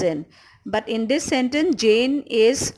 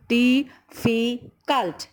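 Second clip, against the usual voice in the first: slow "difficult", a gap after each syllable; much slower than usual. Shown as a demonstration. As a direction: spell out the beats in "difficult": dif - fi - cult. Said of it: "three syllables".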